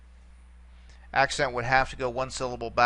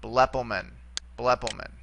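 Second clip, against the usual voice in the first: BLEpomen, accented on the opening syllable; stress on first syllable; 3 semitones lower than usual. Shown as a demonstration. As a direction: hit the ble of blepomen. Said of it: "go all the way back to the beginning".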